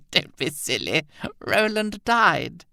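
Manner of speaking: put-on high, slow, dismissive old lady; laughing off the truth. A narrator's reading. Confused mother insisting to Roland her son died, high and slow. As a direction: brush him off as silly, drawn out. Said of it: "She gazed up into his face and laughed".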